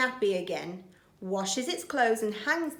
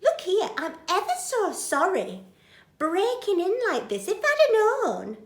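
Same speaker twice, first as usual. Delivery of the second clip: very high, gushing apology; apologetic Betty; 10 semitones higher, 5 dB louder than usual. Acted. Betty apologises to Bill, flustered. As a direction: fluster through it. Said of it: "she says".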